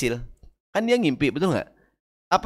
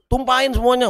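mock grumpy husband; 9 semitones higher than usual. Husband barking at wife.

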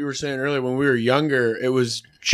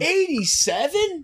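surprised; 12 semitones higher, slower than usual. Real, not acted. Disbelief at the same birth year.

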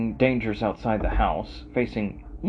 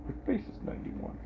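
whispered joke; 12 dB quieter, faster than usual. Real, not acted. Fast whispered mock retort.

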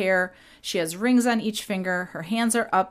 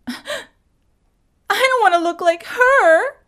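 much higher and louder, a mock wail; wailing Jan; 13 semitones higher, 8 dB louder than usual. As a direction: whine it out like a distraught girl. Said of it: "I'm gonna be doing some impressions".

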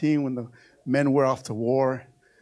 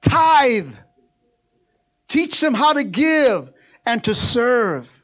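loud, high-pitched sermon building in force; full-force preaching; 11 semitones higher, 12 dB louder than usual. Real, not acted. Preacher urging parents that prodigals will come back, full-force preaching. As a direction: preach it hard, driving each phrase home.

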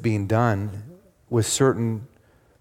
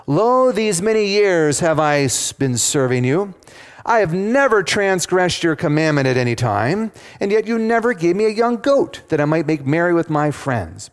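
raised, emphatic voice of a wronged son; aggrieved scripture complaint, pitched up; indignant older son; 6 semitones higher than usual. Acted. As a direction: protest hotly to your father in high, insistent pitch. Older son complains to his father.

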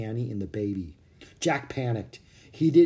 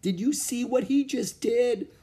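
amazed; 12 semitones higher than usual. Acted. Jack amazed by gorilla.

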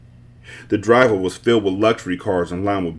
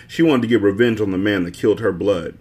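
ordinary delivery, nothing marked

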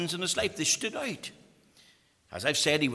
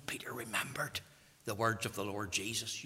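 soft whisper; 6 semitones lower, 9 dB quieter than usual. Acted. Hushed retelling of Peter's remorse.

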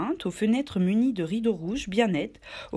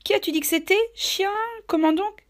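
high, sharp surprised question; startled Silver; 13 semitones higher than usual. Acted. Silver pretending shock at the pirate's name, high and sharp.

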